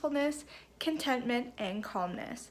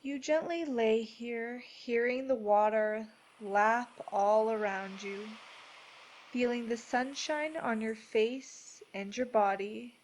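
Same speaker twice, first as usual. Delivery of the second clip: measured, soothing delivery, each phrase given room; guided meditation; 4 dB quieter than usual. Acted. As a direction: guide them gently into peace, unhurried.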